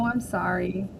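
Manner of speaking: ordinary delivery, nothing marked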